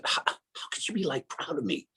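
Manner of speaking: whispered in disbelief, almost no voice; hushed whisper. Retold or imitated. A man whispering to God, unsure he could be loved. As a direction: breathe it out like you can't believe it.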